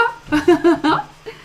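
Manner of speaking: high, loud burst of laughter; amused laugh. Real, not acted. Amusée par le compliment du fan. (rire amusé, aigu et sonore)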